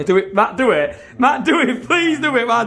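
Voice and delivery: pleading. Real, not acted. Urging Matt to perform.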